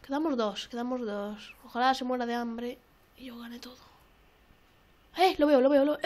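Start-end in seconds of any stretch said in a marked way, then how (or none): 5.2-6.0 excited alarm. Real, not acted. Spots the enemy suddenly.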